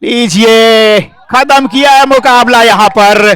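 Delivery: excited cricket commentary. Real, not acted. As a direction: celebrate the match-winning shot. Winning shot ends match.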